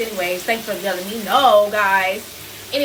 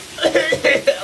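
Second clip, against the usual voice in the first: a mock cough to show the look; imitated cough; 6 semitones higher, 4 dB louder than usual. Imitating the daughter's cough, a mock cough. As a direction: fake a little cough. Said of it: "that look, like, that means".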